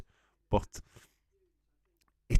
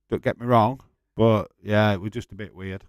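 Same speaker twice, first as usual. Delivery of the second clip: normal talking voice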